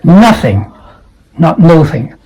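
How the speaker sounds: nothing as nʌθɪŋ, not noʊθɪŋ; nothing, not no-thing; nothing with short u. Shown as a demonstration. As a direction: say nuthing, short u. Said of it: "the second syllable is think".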